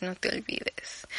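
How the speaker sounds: slow, breathy whispered reminder; susurrado, lento y confidencial. Shown as a demonstration. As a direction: lean in and whisper it like a secret. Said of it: "repeated whispered".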